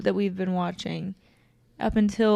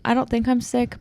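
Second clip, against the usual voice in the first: usual speaking voice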